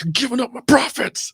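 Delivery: a hammed-up protest over lost money; whiny salesman. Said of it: "the salesman be like".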